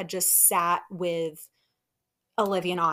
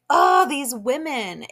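loud, drawn-out exasperated outburst; worked-up exclamation, higher and louder; 5 semitones higher, 7 dB louder, slower than usual. Real, not acted. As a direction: groan it out slowly, fed up. Host groaning over women's behavior.

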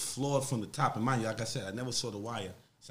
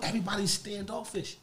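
normal talking voice

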